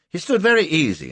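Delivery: ordinary delivery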